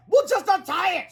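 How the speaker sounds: high-pitched urgent scream. A narrator's reading. Woman screams a fix to the trapped superhero. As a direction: shriek it impatiently. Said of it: "screamed Miss Anthorp".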